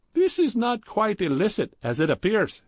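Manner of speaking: put-on high, pleading heavy Indian accent; sheepish Indian accent. A narrator's reading. Badrul caught by Gupta, sheepish Indian accent. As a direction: caught out, trying to explain it away.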